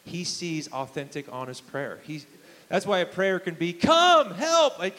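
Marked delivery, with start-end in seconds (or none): 3.7-4.8 high, loud pleading cry to God; urgent shout of "Come, help"; desperate cry for help. Retold or imitated. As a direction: call out like you're at the end of your rope. Desperate simple prayer example.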